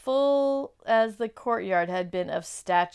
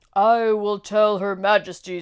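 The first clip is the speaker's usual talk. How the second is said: wolf voice; 11 dB louder than usual. Acted. Wolf answers Edmund.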